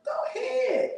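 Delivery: very high squealing cheer. Retold or imitated. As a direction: egg her on with a shriek. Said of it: "I'm like".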